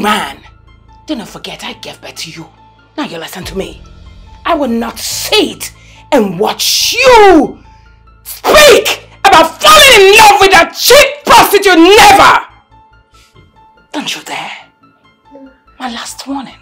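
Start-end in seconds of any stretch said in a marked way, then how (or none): 4.5-12.5 slow, building to a loud shout; furious ultimatum. Acted. Mother shouting her son down over the girl. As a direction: lay down the law, rising to a yell.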